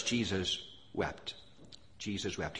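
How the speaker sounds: soft, low, reverent reading; hushed and solemn. Real, not acted. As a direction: let the verse drop to a grave hush. Solemn reading of moving verse.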